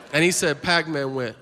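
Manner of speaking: usual speaking voice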